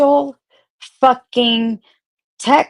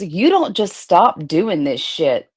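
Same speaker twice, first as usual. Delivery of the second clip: ordinary talk, nothing marked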